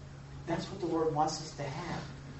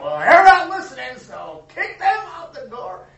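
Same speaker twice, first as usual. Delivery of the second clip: mock harsh voice, higher and much louder, slowing on the end; harsh dismissive boss; 9 semitones higher, 16 dB louder than usual. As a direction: play a cold leader throwing people out. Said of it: "It wasn't like".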